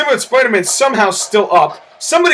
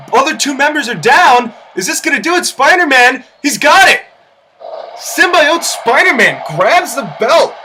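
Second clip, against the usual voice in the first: excited commentary; 5 semitones higher than usual. Real, not acted. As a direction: call the winning grab. Excited call of the win.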